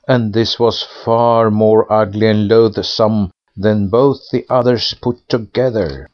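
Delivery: usual speaking voice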